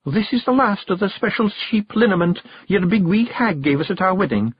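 high-pitched Scottish wife offering a treat; Scots wife, coaxing. A narrator's reading. Jeanie offers Rob the wedding liniment, coaxing. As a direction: coax him in a high Scots voice.

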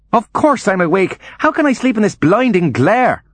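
high-pitched, indignant complaint; fretful invalid mother protesting; irritated outburst. Acted. Bedridden mother snapping at son.

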